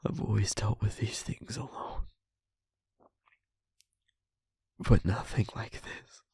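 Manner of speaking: very soft, low, half-whispered; subdued confiding. Acted. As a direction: confide haltingly, drained after crying, with long pauses. Spent, confiding after crying.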